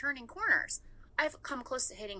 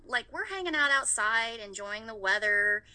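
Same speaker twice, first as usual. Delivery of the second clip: ordinary delivery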